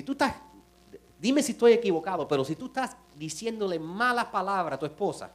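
loud, driving sermon build; fiery preaching. Acted. Pastor challenging husbands in church, loud fiery preaching. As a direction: preach it hard to the crowd.

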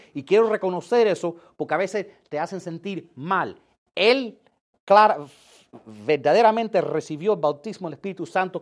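loud, rising sermon delivery; preaching. Acted. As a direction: build it up like a preacher. Preacher raising voice to congregation.

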